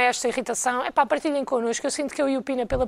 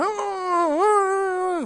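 sharp gasp before a sneeze; pre-sneeze gasp; 4 semitones higher than usual. Retold or imitated. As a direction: catch breath like about to sneeze.

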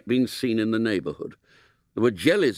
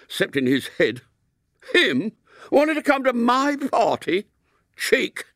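much higher, squeaky schoolboy outrage; indignant outburst; 13 semitones higher than usual. Acted. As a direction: spit out the last word in disgust. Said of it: "said William tempestuously".

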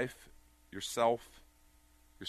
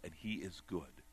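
normal talking voice

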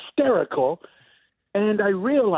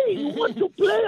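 sing-song taunt pitched much higher; teasing mom, higher; 11 semitones higher than usual. Retold or imitated. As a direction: push the teasing up into a squeal. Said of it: "my mom is hopping up and down going".

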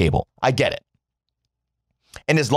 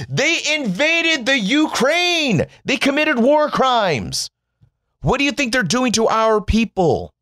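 rising outrage; 10 semitones higher than usual. Real, not acted. Ranting at Geneva Conventions believers.

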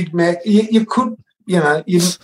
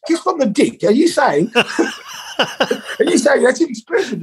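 high, disbelieving squeal, laughing midway; a pious man repeating a rude phrase; incredulous accountant; 10 semitones higher than usual. Retold or imitated. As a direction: pitch way up, asking in scandalised shock. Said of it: "he looks at me and goes".

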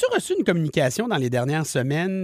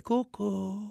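soft, higher cooed catchphrase; 4 semitones higher, 10 dB quieter, much slower than usual. Acted. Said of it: "tu as la voix d'[name]".

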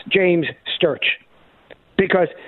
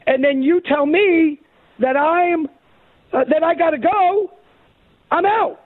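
higher, slowing outrage as the expelled man; indignant retelling; 7 semitones higher than usual. Retold or imitated. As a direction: play the wronged man building to exasperation. Voicing the guy's outrage.

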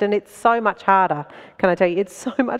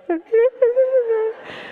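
squealing laugh; 13 semitones higher than usual. Real, not acted. Laughs at own nerves.

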